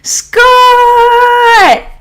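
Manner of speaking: loud, high, long-held sung-out name; exuberant shout-out. Real, not acted. Streamer greeting Scott joining the stream, exuberant drawn-out call. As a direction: belt the name out on one long high note. Calling out to arriving viewer.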